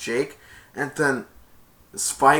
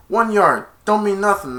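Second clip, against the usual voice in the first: raised, scoffing call; loud dismissal; 6 semitones higher, 6 dB louder than usual. Retold or imitated. Kevin brushing off the gain, raised scoffing call. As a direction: wave it off out loud.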